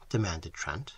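ordinary delivery

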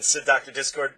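usual speaking voice

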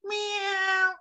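high, drawn-out cat meow; long high mewing cry. Shown as a demonstration. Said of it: "A cat".

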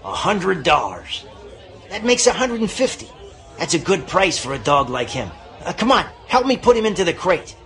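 fast, gruff saloon man; brisk dealer pushing a sale; rough man voice. Acted.

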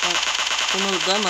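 loud, rising don't move; loud command. Real, not acted. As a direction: bark it at the target. Aiming at enemy, louder. (yüksek sesle emreder gibi)

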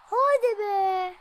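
loud, high and slow "come on"; thrilled gamer urging his player on; excited cheer. Real, not acted. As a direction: cheer your player on at full voice.

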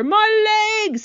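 loud, high outraged cry; angry scream. A narrator's reading. Centipede doused with purple paint, screaming with anger. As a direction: scream it in fury. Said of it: "The centipede screamed with anger".

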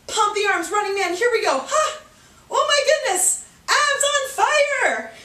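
high, energetic workout push; excited coach cheering through the effort; fired-up urging. Real, not acted. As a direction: urge them on at a high pitch through the burn. Urging through a hard running burst.

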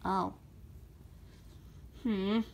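normal talking voice

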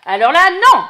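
loud, firm no; loud protest; loud objection. Real, not acted. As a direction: stop the story short. Listener objects to story.

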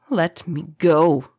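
slow, strained croak. A narrator's reading. Choked captive demands release, slow croak. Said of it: "croaked Karis".

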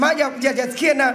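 normal talking voice

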